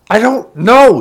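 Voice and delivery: high, loud desperate shout; shouting back. A narrator's reading. Taryn answers the screaming woman, shouting back. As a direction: shout it back at full force. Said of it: "he shouted back".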